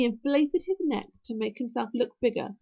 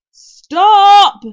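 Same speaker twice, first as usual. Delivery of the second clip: full, high-pitched shout; yell; 10 semitones higher, 21 dB louder than usual. A narrator's reading. Hiccup yelling at Toothless as feathers fly, shouting. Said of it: "yelled Hiccup".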